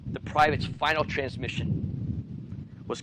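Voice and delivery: usual speaking voice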